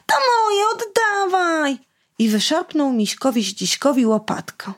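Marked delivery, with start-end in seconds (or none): 0.1-1.8 put-on much higher, whiny crying yell of a little boy; boy shouting. Boy snatching the spade from Misiek, crying shout. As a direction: wail it like a toddler grabbing back his toy. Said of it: "chłopiec krzyknął".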